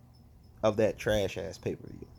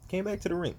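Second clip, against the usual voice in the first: pitched up in disbelief; incredulous; 7 semitones higher, 3 dB quieter, faster than usual. Real, not acted. Wrestling fan ranting to viewers, incredulous. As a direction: stress BACK, higher, can't believe it. Repeats line, higher, incredulous.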